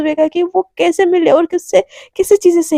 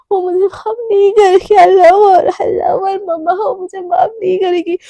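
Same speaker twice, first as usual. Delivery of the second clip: high, loud sobbing plea with catches in the voice; sobbing confession; 3 semitones higher, 4 dB louder than usual. Acted. Daughter sobbing to her mother after hurting her friend, crying. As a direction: break down crying to your mother. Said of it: "ऐसे बोल के ना ... रो रही होती है".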